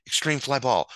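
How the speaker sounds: ordinary talk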